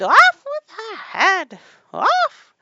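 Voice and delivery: very loud, high, stretched shriek; furious scream. A narrator's reading. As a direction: scream the royal death order.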